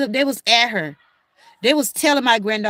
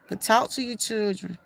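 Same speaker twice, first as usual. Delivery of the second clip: usual speaking voice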